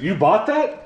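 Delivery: incredulous question leaping high at the end; condescending, sharply rising disbelief. Real, not acted. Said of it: "I said that very condescendingly".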